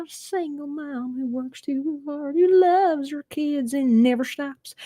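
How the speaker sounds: high, slow Oklahoma twang imitating [name]; [name]'s thick Oklahoma accent, high and slow. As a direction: drawl the line like [name]. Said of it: "it's very".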